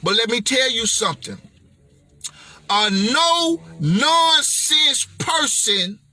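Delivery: normal talking voice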